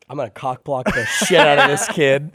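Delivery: slow, loud shout with a laugh; hammed-up cop bragging to his partner; mock gleeful cop. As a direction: crow it like a smug cop.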